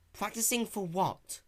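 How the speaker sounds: a hint of a young dragon, fumbling; nervous Spike. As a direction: cover it up innocently. Spike voiced.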